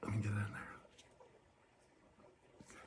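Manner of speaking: soft unvoiced whisper. Doctor leaning in close to the eye, whisper. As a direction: breathe it as you lean in.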